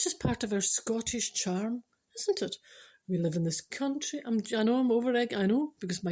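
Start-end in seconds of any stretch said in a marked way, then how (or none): none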